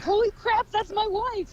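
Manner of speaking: high, excited cry of surprise; shocked shout. Retold or imitated. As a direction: blurt it out in disbelief. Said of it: "We're like".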